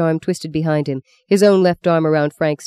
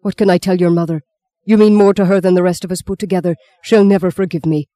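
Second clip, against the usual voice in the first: quick, pressing, raised appeal; urgent pleading; 3 semitones higher, 5 dB louder, faster than usual. A narrator's reading. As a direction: beg him anxiously to stay. Father begs son to stay.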